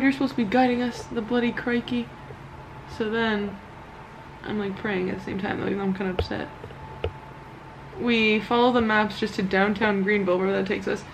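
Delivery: usual speaking voice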